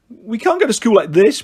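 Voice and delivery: put-on high, loud boy's protest; whiny complaint to mum. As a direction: object like an upset child. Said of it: "protested Tom".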